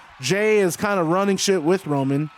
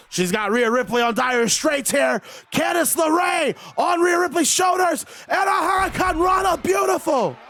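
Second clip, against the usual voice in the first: higher and higher hype as the move lands; rising excited call; 9 semitones higher than usual. Acted. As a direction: build to a big announcer climax. Climax of match commentary.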